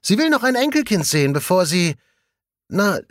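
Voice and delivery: put-on chatty young colleague confiding; colleague Jasper. A narrator's reading. Jasper talking about his mother. (leicht gespielter junger Kollege)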